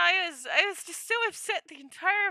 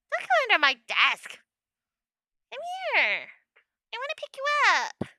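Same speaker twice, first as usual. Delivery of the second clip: coaxing the cat; 7 semitones higher than usual. Real, not acted. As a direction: coax the cat into your arms, high and sweet.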